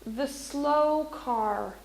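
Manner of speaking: drawn-out slow phrase; slow example. Shown as a demonstration. Teacher drawing out an example slowly. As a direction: stretch the words out slowly. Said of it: "So what if I said".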